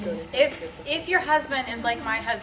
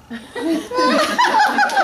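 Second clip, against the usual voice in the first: burst of laughter; 12 dB louder than usual. Real, not acted. Laughing at husband's reply.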